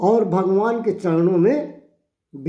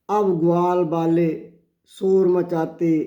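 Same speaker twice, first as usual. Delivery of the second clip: ordinary talk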